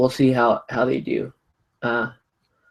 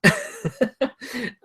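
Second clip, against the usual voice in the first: short high laugh after sneeze; relieved laugh; 12 semitones higher, 4 dB quieter than usual. Real, not acted.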